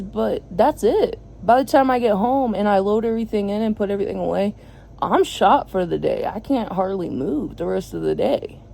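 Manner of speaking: ordinary delivery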